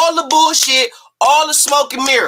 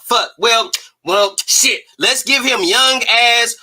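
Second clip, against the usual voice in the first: ordinary talk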